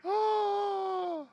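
a high, shouted oh of delight; joyful cry. Acted. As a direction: let the joy burst out. Joy at meeting Jesus.

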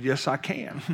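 chuckling through the answer; amused reply while laughing. Retold or imitated. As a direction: say it with a laugh breaking in. Helping young man with app.

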